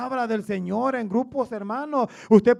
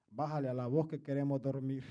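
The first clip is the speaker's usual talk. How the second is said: much softer and lower, a mock sleepy wife; imitating wife, hushed; 10 semitones lower, 13 dB quieter than usual. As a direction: hush it like a wife asking for quiet at night. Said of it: "y mi esposa me decía antes". (imitando a la esposa, bajito y grave)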